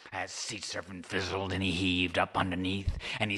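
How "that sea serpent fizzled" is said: plain reading voice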